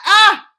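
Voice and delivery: high, loud fan scream; geeky joyful scream; excited scream. Retold or imitated. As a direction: shriek with delight. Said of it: "I came in here from Texas like".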